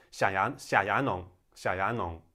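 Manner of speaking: "xia ya nong" said as in Shanghainese; Shanghainese pronunciation. Shown as a demonstration. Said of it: "in Shanghainese".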